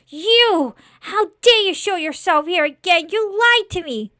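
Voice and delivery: high, loud, indignant outburst; outraged accusation. Acted. Scarecrow confronts the lord who lied, outraged. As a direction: shout the accusation at full outrage.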